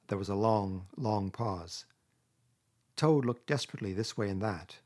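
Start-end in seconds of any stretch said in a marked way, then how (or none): none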